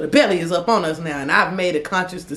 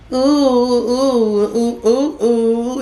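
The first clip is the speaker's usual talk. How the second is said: high, loud panicked oohs; 5 semitones higher, 4 dB louder, much slower than usual. Retold or imitated. Ex panicking as store security closes in, loud high oohs. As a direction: squeal it out in alarm.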